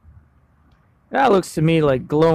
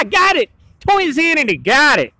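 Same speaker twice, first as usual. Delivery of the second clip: excited; 10 semitones higher, 3 dB louder than usual. Real, not acted. Triumphant after remembering.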